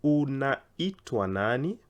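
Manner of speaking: "Unaitwa nani?" drawn out very slowly, rising at the end; slow, syllable by syllable. Shown as a demonstration. Teacher showing learners the right syllables, very slow. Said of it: "trying to go slow so that we can get the intonations".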